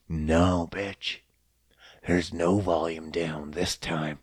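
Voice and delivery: a menacing machine gloating quietly; cold machine voice. Acted. Machines answer coldly.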